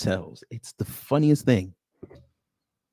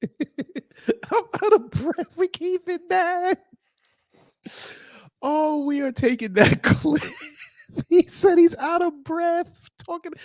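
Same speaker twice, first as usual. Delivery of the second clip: very high, helpless laughing speech; laughing hard; slower than usual. Real, not acted. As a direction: squeeze the words out through real laughter. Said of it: "I was laughing so hard".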